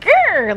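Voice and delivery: high loud growl of pain; mock pain growl. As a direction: growl like it hurt. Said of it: "it was like".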